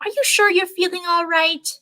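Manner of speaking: high, concerned motherly question; worried mother voice. A narrator's reading. Mother checks on her daughter, worried. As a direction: ask it like a fretting mom.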